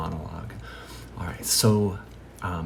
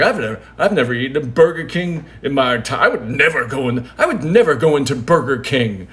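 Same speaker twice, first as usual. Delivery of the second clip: loud, quick, emphatic insistence; hammed-up outraged refusal; mock indignant denial; 4 semitones higher, 8 dB louder, faster than usual. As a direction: imitate a liar loudly doubling down. Waiter insists on his absurd claim.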